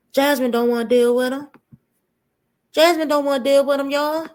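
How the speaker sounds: high, fast mocking whine; a teasing put-on complaining voice; mock whiny voice. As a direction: whine it like a fed-up mom. Mocking host's complaint in her voice.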